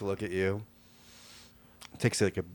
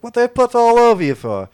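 high, loud urchin voice fawning over the dog; cheeky urchin sweet-talking a giant dog; street kid voice; 16 dB louder than usual. Acted. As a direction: coo at the big dog like a scrappy street kid.

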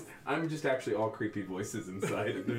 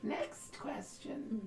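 creepy slow oracle; 12 semitones higher, 7 dB quieter, much slower than usual. Acted. Oracle dismisses question.

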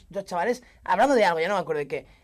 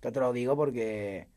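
deadpan stranger, lower and slow; mock-serious low voice; low, slow stranger's voice; 10 semitones lower, 7 dB quieter, slower than usual. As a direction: lower and slower, imitating a stranger. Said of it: "me dice el tío".

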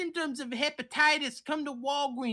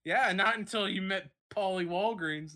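ordinary talk, nothing marked

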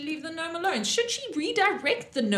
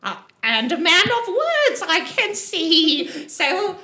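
louder, higher, bossy gnome voice; rally the party like a pushy little gnome; brash gnome woman; 7 semitones higher, 6 dB louder than usual. Acted.

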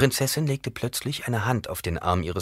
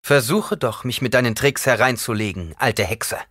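loud, raised challenge; defiant taunt; 5 semitones higher, 6 dB louder than usual. A narrator's reading. Paul challenging the old Reverend Mother, defiant. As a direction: throw it at her as a challenge.

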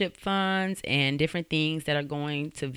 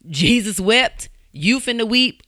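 Jesus wept drawn out slow and weighty, louder; slow preacher emphasis; 5 semitones higher, 4 dB louder than usual. Shown as a demonstration. To churchgoers, a slow weighty mock-sermon line. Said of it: "I ain't gonna say it like that".

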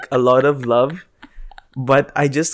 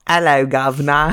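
playful, hammed-up British accent; mock British accent. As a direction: toss off a cartoon British greeting in a British accent. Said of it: "a British accent".

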